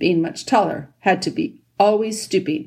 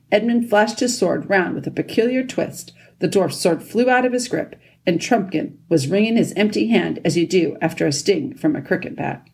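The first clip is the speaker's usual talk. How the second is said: plain reading voice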